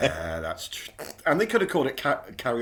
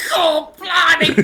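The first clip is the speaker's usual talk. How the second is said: mock startled exclamation; 13 semitones higher, 8 dB louder, much slower than usual. Cameraman voiced on film set.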